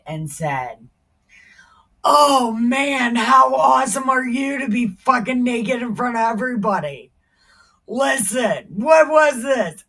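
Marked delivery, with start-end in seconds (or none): 2.0-9.8 loud, outraged scolding; angry wife yelling. Acted. Wife scolding her husband for dancing naked, outraged yell. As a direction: chew him out for dancing naked.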